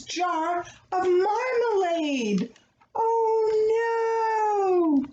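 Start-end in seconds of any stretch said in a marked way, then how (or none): 2.9-5.1 high, rising oh no in alarm; dismayed gasp. A narrator's reading. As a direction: cry out in dismay as the bear. Bear sees jar buried.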